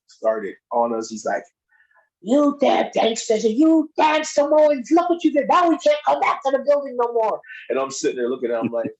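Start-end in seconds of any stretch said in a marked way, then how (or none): 2.2-7.4 mock scolding. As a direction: imitate the furious promoter. Angry boss scolding the wrestlers.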